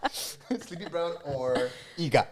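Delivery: slow, high laughing voice. Real, not acted. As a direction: laugh the names out slowly. Laughing mock.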